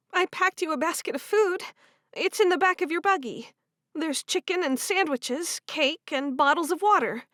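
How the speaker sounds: lightly voiced kind sister; young woman voice. Female character voice in story.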